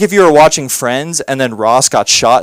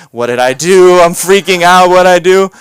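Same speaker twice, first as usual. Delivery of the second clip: mock panic; 4 semitones higher, 6 dB louder than usual. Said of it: "I'm like".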